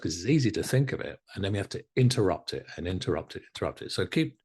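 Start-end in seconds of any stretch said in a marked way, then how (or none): none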